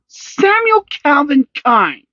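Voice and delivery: high, loud, slow stern mother; scolding full name. A narrator's reading. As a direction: warn the boy by full name.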